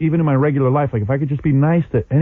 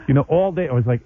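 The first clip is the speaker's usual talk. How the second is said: normal talking voice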